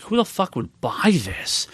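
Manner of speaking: slow, disbelieving mock outrage; a slow, drawn-out incredulous jab; incredulous scoff. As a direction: scoff at it like it's absurd. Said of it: "and I'm like".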